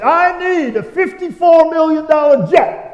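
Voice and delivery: high, slow, put-on voice of a greedy televangelist; mock greedy preacher. Pastor mocking a televangelist's demand, high, slow mock voice. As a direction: lampoon the jet-begging preacher. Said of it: "there's a preacher saying".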